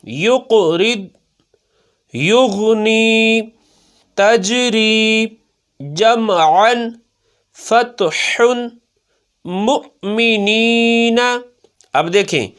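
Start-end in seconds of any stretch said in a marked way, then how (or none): 0.0-11.2 each word stretched slowly, clear qalqala; slow Quranic word drill; slow qaida recitation. Shown as a demonstration. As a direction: recite carefully for a pupil. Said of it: "قلقلہ خوب ظاہر ہوگا".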